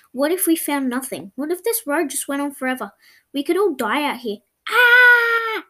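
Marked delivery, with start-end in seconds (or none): 4.7-5.7 piercing, very high, loud scream of despair; full scream. A narrator's reading. Lost girl screaming her frustration at the empty road. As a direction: let out a wild desperate scream. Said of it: "I screamed as loud as I could".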